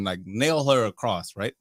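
normal talking voice

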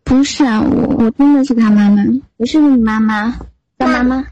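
ordinary talk, nothing marked

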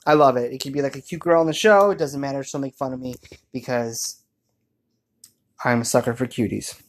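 ordinary talk

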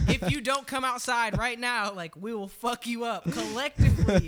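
high, loud furious warning; angry threat. Retold or imitated. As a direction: shout the threat at him. Threatening the guy at door.

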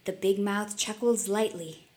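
ordinary delivery

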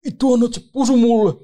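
loud, high-pitched indignant shriek; outraged yell. Retold or imitated. Smaller heckler enraged at the blown kisses, outraged yell. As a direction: explode in disbelief, pitch shooting up. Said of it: "se pienempi rupesi tärisee".

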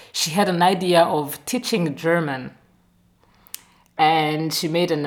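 ordinary delivery

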